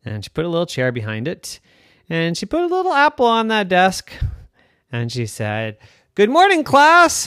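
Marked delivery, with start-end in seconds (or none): 6.1-7.3 high, loud cheery teacher voice; bright teacher greeting. Acted. Girl playing teacher greets her class cheerfully.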